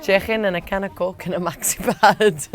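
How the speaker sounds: hammed-up Scouse for a laugh; Scouse accent. Shown as a demonstration. Doing the Scouse line to crack up the room, in a full Scouse accent. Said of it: "Oh, the Scouse".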